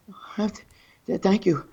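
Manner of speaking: hesitant, halting mock thanks; imitated frozen starstruck stammer. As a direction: play her tongue-tied, with pauses. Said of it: "it was funny 'cause she froze".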